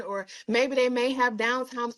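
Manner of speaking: ordinary delivery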